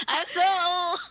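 high, loud mock wail; mock lament. Friend joking about high school, high mock lament.

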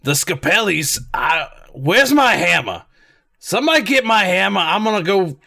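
riled-up Brooklyn plumber; Brooklyn accent. Acted. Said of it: "my rough Brooklyn accent".

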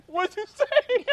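high, loud mock disbelief; incredulous. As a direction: demand it as a stunned dad. Father reacts to news, retold.

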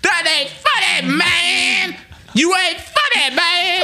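high, slow wailed heckle; relentless singsong heckler. Retold or imitated. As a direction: drag out each word high, mocking the heckler. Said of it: "dude would not stop".

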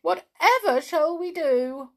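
high, loud fretful pixie; high-pitched cry of dismay. A narrator's reading. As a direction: wail it as a worried pixie. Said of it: "cried the biggest pixie".

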